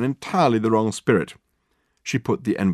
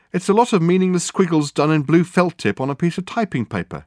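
lightly voiced higher secretary; a touch of exasperation, pitched up; unimpressed secretary; 9 semitones higher, 4 dB louder than usual. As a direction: dismiss it in a higher voice.

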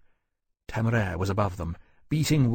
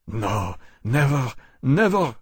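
slow, insistent denial; earnest Frenchman denial; 2 semitones higher, slower than usual. Acted. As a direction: plead sincerely he means no harm. Choiseul denies, pleading.

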